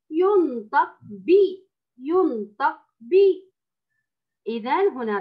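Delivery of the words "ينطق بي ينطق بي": slow, clear ينطق بي with rising بي; slow careful demo. Shown as a demonstration. Teacher drills the kasra sound for Ola, slowly. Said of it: "حركته الكسر".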